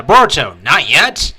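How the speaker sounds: urgent warning. Acted. Sarda stops Boruto mid-fight, urgent warning call. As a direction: warn a teammate to hold off.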